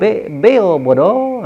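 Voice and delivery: drawn out slowly, syllable by syllable; slow parrot coaching. Retold or imitated. Preacher teaching the parrot its words, very slow. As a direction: spell it out slowly as if training a parrot.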